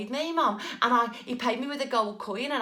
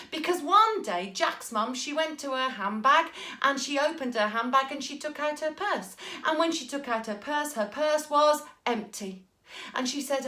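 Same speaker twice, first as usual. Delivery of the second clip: put-on lively read-aloud to children; storytelling narration; 4 semitones higher than usual. A narrator's reading. Storyteller drawing children in with lively narration. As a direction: tell it to the little ones with relish.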